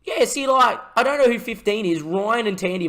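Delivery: high and loud exasperated complaint; heated protest; indignant. Real, not acted. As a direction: complain hotly about the opponent. Complaining about foul on keeper.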